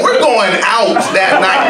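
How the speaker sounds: ordinary delivery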